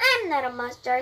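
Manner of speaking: ordinary delivery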